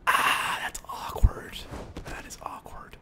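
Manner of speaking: soft embarrassed whisper; awkward whisper. Real, not acted. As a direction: breathe it out, caught in a blunder. Awkward mistake, whispered aside.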